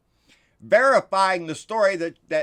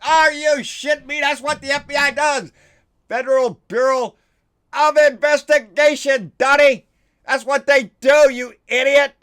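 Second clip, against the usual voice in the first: louder and higher, furious at Donnie; exasperated yelling; exasperated outburst; 7 semitones higher, 8 dB louder than usual. Real, not acted. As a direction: yell it at him like he's dense. Ranting at Donnie over FBI.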